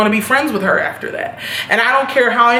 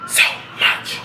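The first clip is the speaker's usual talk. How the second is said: whispered echo; 4 dB quieter than usual. Real, not acted. Overwhelmed, echoed softly.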